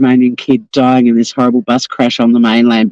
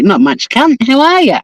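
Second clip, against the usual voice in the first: broad bogan reply, higher; cheeky Aussie bogan voice; mock Aussie bogan; 9 semitones higher than usual. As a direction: play the casual Aussie larrikin. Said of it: "you're all like".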